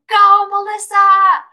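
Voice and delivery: high, loud drawn-out cheer from the stands; slow, stretched sideline yell; cheering dad. Acted. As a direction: holler like a proud parent at a game. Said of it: "my dad in the stands".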